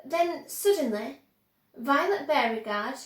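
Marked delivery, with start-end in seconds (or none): none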